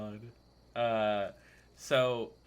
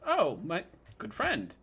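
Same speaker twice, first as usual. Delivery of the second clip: excited greeting; 10 semitones higher, 6 dB louder than usual. Acted. GM voices Rictavio greeting warmly.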